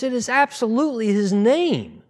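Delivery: high, loud insistence; emphatic high retort; emphatic quote. Retold or imitated. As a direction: insist hard on every word. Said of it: "He goes".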